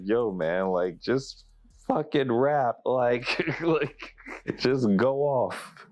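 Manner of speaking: high-pitched, worked-up plea with a laugh breaking in; mock exasperation, pitched well up; exasperated urging. Voicing [name] pleading with [name].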